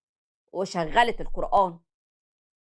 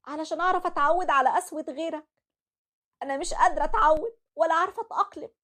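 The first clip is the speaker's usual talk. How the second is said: grieving daughter; 8 semitones higher than usual. Acted. Daughter confiding in her late father's photo, heartbroken. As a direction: pour your heart out to a lost father.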